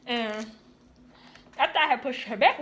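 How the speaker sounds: ordinary talk, nothing marked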